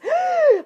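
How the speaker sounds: sharp high gasp of alarm. Retold or imitated. Partner hears a hard topic coming, gasps in alarm. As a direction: gasp loudly like someone bracing for a fight.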